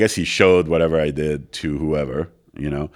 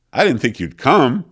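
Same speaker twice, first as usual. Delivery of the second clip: higher and louder, caught off guard; surprised; 9 semitones higher, 7 dB louder than usual. Retold or imitated. Producer surprised the guest showed up, higher and louder. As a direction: sound genuinely startled he came.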